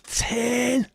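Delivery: high, held Scouse "Turn!"; sudden high-pitched Scouse terrace cry; Scouse crowd shout. Acted. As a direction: yell it like a whole stadium of Scousers. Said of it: "The word turn in a Scouse accent".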